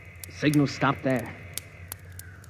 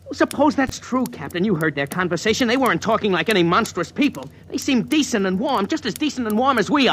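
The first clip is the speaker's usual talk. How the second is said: urgent pleading; 7 semitones higher than usual. Acted. Dort pleads with suspicious captain.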